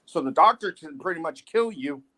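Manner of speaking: ordinary talk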